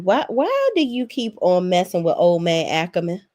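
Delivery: usual speaking voice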